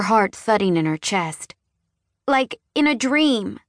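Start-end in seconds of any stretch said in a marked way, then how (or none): 2.3-3.6 put-on small child. A narrator's reading. Child explaining vision.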